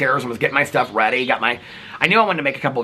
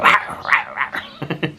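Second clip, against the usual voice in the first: rough little dog "rawr" noises; imitated dog grumble; 4 semitones higher, much slower than usual. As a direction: mimic a small dog grumbling as it wakes. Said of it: "she would wake him up and you go".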